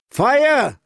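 high, loud alarm scream. A narrator's reading. Lookout on the barbican screaming down to the lords, high and loud. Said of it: "a voice screamed down from atop the barbican".

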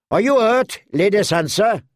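high call across the yard. A narrator's reading. Tyrion calling across the yard to the injured girl, high and urgent. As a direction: call out to her over the noise. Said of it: "Tyrion called to her".

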